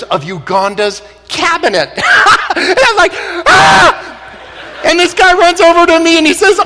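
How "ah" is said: very high mock scream; shocked scream. Seeing Uganda's cabinet at the airport, shocked scream. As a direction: shriek it at the very top of your voice.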